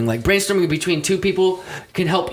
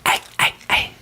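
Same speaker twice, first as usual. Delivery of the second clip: scary voice. Pretend. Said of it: "That's scary, kinda".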